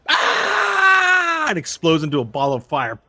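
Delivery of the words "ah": long, loud, high-pitched scream; death scream. Acted. Rubles screams as he explodes on screen, drawn-out high scream. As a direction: scream out "Ah!" at full volume. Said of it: "just goes".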